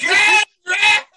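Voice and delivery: high, loud shout through laughter; laughing shout. Real, not acted. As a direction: yell it while cracking up. Laughing shout repeating name.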